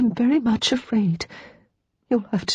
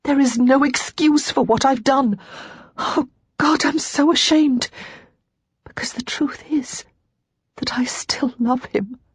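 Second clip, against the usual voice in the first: anguished; 5 semitones higher than usual. Acted. Lara's anguished confession.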